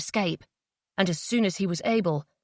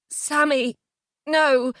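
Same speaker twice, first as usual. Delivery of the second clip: tearful, high, pained plea; anguished pleading; 6 semitones higher, 3 dB louder, much slower than usual. Acted. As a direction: beg through rising tears. Jay breaking down in tears.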